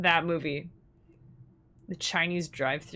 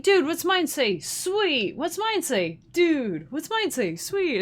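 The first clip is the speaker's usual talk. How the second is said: high-pitched back-and-forth movie dude voices; 8 semitones higher, slower than usual. Retold or imitated. Two movie characters comparing tattoos, high mock dude voices. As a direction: imitate two dudes volleying lines, pitched up. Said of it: "they just go back and forth".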